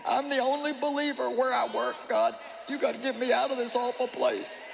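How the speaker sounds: lightly mocking a whining believer; a hint of a self-pitying whine to God; whiny self-pitying prayer. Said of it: "Come on, you know how it sounds".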